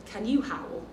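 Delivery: ordinary delivery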